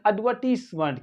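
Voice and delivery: एडवर्टीजमेंट as "ud-VUR-tiss-munt" with silent R; soft S. Shown as a demonstration. Teacher models British word, soft S and no R. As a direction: hiss a soft S, not Z, in एडवर्टीजमेंट. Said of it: "क्या पढ़ेंगे".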